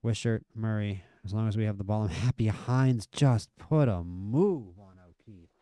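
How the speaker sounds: usual speaking voice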